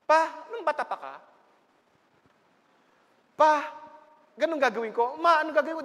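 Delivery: put-on a high, eager child asking dad and mom. Child eagerly asking father and mother for advice, high and lively. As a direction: play the child who runs to a parent for advice.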